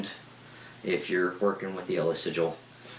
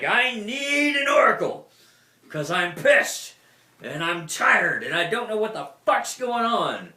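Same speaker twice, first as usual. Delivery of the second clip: much higher, much louder furious yell; angry shout; 9 dB louder than usual. Retold or imitated. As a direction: bellow it at the sky, fed up. Acting out summoning an oracle.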